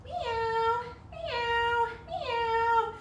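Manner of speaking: high, sweet kitty meows; high cat meows. Shown as a demonstration. Teacher meowing high for the kids in cat pose. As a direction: meow up high like a kitten.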